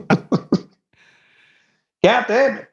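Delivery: high mock-Texan cussing; Texas accent. Showing off for the host, high Texas accent. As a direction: cuss in a put-on Texas accent. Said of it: "do your Texas accent".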